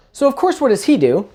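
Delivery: ordinary talk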